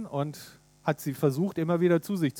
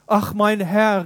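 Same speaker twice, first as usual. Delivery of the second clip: louder, pleading cry; protesting Gideon; 4 semitones higher, 7 dB louder, slower than usual. Acted. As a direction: cry out in disbelief. Gideon cries out. (Gideon ruft laut und flehend)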